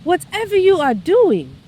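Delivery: doctor telling his patient she is cancer-free, high and grave; solemn announcement. Retold or imitated. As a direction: let the good news land. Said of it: "He goes".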